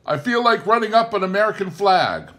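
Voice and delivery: high, loud call from a platform; shout. A narrator's reading. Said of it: "Bob shouted down from the high platform".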